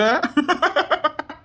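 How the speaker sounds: high, crowing villain laugh; gloating laugh. Acted. Schemer gloats to accomplice, villainous laugh. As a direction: cackle over the catch.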